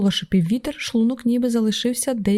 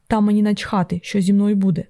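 plain reading voice

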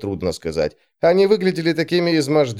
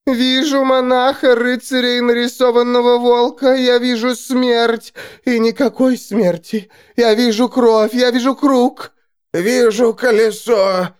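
prophesying seer; 12 semitones higher, 3 dB louder than usual. A narrator's reading. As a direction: cry visions out in trance. Seers prophesying.

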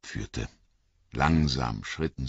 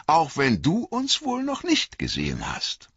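much higher, louder knowing elf voice; knowing elves; 12 semitones higher, 4 dB louder than usual. A narrator's reading. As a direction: sound mysteriously amused. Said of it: "sagten sie".

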